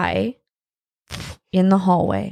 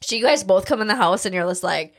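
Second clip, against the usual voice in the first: usual speaking voice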